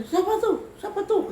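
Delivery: high, loud panicked cry of who's there; possessed woman asking fearfully who is there, high and loud; frightened. Retold or imitated. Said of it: "tapi gini".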